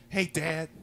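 a hint of an aggrieved little boy; five-year-old self. Grown man replaying a childhood argument with dad, lightly voiced as a kid. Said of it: "and five years old".

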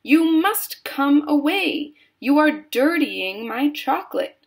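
high, loud agitation; crying out. A narrator's reading. Mister Wonka calling Augustus away from his chocolate, crying out. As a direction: plead and scold with rising fluster. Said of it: "cried Mister Wonka".

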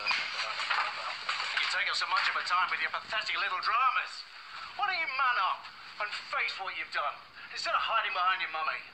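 loud, heated scolding; furious telling-off. Acted. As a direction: tear into them at full volume.

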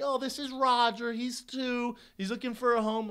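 a hint of a sweet pet-segment host; TV adoption lady. News lady introduces an adoption pet to viewers, lightly put-on presenter voice.

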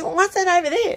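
very high mock wife voice; put-on falsetto-like feminine asking; wife's voice. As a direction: squeak out a curious question as the wife. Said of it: "She goes".

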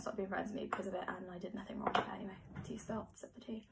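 gossipy whisper; hushed, spilling the tea. Real, not acted.

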